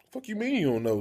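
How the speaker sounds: high disbelieving outburst; incredulous. Retold or imitated. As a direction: shoot back in disbelief. Incredulous retort to roommate.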